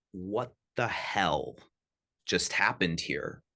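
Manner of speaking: mild bewildered muttering to yourself; stunned. Retold or imitated. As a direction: say it as if you can't believe your eyes. Stunned after kid calms instantly.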